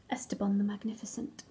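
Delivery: breathy grand whisper of the name; hushed, proud signature; whispered sign-off. Acted. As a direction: whisper the grand title like a secret. Crayon signs off grandly in whisper.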